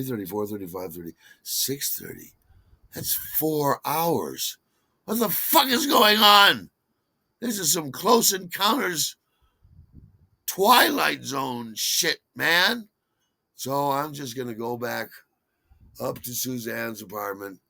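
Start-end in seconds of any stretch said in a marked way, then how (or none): 5.0-12.9 shouting what the fuck, higher and louder; loud disbelief. Retold or imitated. Alone on the platform, he shouts in disbelief. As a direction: blurt out your alarm at lost time. Bewildered by lost hours.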